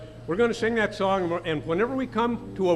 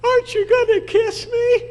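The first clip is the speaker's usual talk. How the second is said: very high, louder squeaky frog; 6 dB louder than usual. Retold or imitated. Frog in the pocket pestering the old man, very high squeak. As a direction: pipe it up high and indignant. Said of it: "the frog says".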